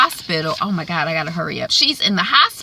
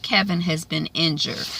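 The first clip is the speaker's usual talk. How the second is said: ordinary talk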